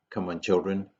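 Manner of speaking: ordinary talk, nothing marked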